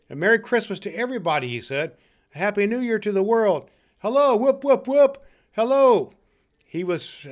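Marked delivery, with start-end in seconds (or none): 0.1-1.5 high, quick exclamation; jubilant greeting. Acted. Scrooge wishes everybody well, jubilantly. 2.4-6.1 high, loud, exuberant calls; jubilant whooping. Acted. Scrooge greets the whole world, whooping jubilantly.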